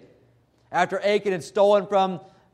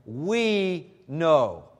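WE stretched out and leaned on hard; stress on WE. Shown as a demonstration. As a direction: hit "We", draw it out before "know". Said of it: "the emphatic word there is".

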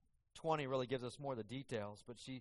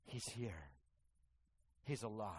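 soft, lower, a touch of hushed amazement; hushed wonder; 5 semitones lower, 8 dB quieter than usual. Acted. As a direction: breathe it out in quiet awe. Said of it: "from distraught and weeping to".

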